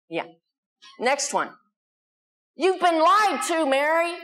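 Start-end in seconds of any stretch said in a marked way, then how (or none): 2.6-4.1 high, urgent alarmist headline; dramatic raised-pitch email alert; all-caps alarm. Acted. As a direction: read it out like an all-caps warning. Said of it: "All caps, exclamation point".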